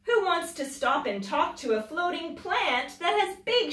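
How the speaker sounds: ordinary delivery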